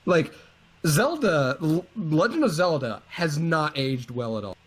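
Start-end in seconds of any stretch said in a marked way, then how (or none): none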